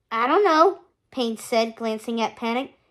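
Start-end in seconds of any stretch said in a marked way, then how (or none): 0.1-0.8 nervous minion. A narrator's reading. Minion nervously answers angry boss.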